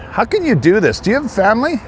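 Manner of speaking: mock-indignant demand; indignant challenge. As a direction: shame the scammer with a rising plea. Confronting scammer on the phone.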